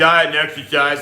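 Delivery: a mocking imitation of a lazy doctor; mock smug doctor. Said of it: "the fat doctor I had was like".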